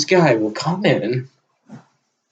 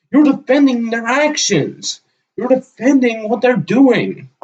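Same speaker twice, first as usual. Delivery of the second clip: furious ranting; 8 semitones higher than usual. Real, not acted. Vlogger yells at the man he disagrees with, furious. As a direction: let real anger boil over and keep it there. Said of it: "Even I'm getting fucking pissed off".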